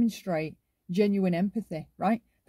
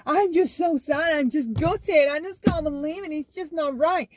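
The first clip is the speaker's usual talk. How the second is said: hammed-up, over-the-top devastation; shouted histrionic sadness; 6 semitones higher than usual. Shown as a demonstration. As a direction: overact being gutted, pitch raised. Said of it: "They have to shout about what emotion".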